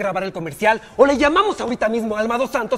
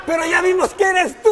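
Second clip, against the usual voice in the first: louder, higher teasing line breaking into a laugh; laughing tease; 9 semitones higher, 4 dB louder than usual. Real, not acted. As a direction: crack up at your own joke. Director teasing her, bursting out laughing. (el director se burla y suelta la carcajada)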